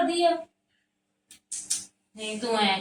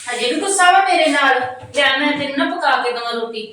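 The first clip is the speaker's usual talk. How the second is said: much higher and louder, pleading to make up; loud coaxing; 5 semitones higher, 10 dB louder than usual. Acted. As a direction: raise the voice, eager to make amends. Sister-in-law coaxing after quarrel. (ਉੱਚੀ ਤੇ ਮਨਾਉਣ ਵਾਲੀ ਆਵਾਜ਼)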